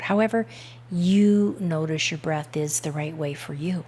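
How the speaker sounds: calm, measured hypnotic induction voice; guided meditation. Real, not acted. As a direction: guide the listener into relaxation with unhurried pauses. Leads breath-focused guided meditation.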